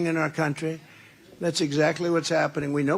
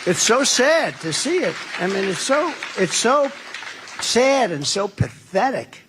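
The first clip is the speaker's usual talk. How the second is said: higher, louder, slow at the end; loud lament; 5 semitones higher, 4 dB louder than usual. Real, not acted. Rally speaker lamenting veterans on the streets, loud. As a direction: lament it to the crowd.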